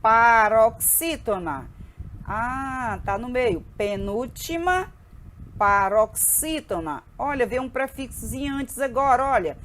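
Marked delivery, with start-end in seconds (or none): 0.0-1.7 louder, drawn-out syllables; deliberate teacher drill; slow syllable-by-syllable. Shown as a demonstration. Teacher matching terms slowly. 3.8-7.0 drawn-out, evenly spaced syllables; match the two terms slowly for a class; deliberate teacher drill. Shown as a demonstration.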